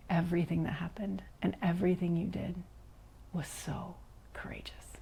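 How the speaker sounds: hushed whisper fading to almost nothing; awed whisper of praise; retold whisper. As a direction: breathe it like a heartfelt secret. Quiet teacher praises her courage.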